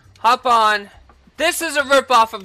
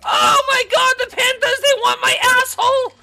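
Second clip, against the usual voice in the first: high-pitched panicked yelling; frantic screaming rant; 10 semitones higher, 4 dB louder than usual. Real, not acted. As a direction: screech it, climbing to a scream at the end. Frantic rant as panthers attack.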